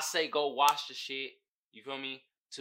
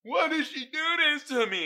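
much higher, whiny imitated cry of betrayal; high mock distress; 11 semitones higher, 5 dB louder than usual. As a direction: squeak it out as the betrayed guy. Voicing character's shock.

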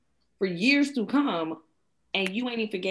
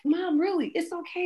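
put-on much higher, sweet small-child voice; imitating her daughter; 12 semitones higher than usual. Mother voicing her daughter forgiving her, high childlike. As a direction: play a gentle six-year-old comforting mom. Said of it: "She's like".

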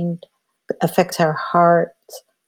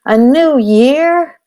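higher, hammed-up child's dismay; dismayed little boy; 8 semitones higher, much slower than usual. Three-year-old boy groaning to his mom about New Year, higher mock dismay. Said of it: "And he says".

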